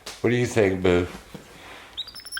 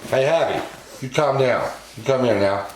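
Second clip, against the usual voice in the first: higher, firm call to the pet; raised, commanding pet call; 6 semitones higher than usual. Real, not acted. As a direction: call him to settle down. Calling the wild monkey to order.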